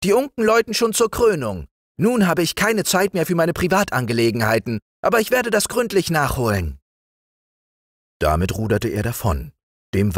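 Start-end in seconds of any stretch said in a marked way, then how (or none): 0.0-6.8 a self-important frog crowned king; pompous frog king. Acted. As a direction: order him about like a little tyrant. Prince exclaims.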